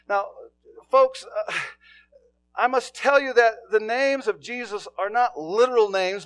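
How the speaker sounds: high, loud preacher's call; preaching at full force. Acted. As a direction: lift the voice and preach to the room.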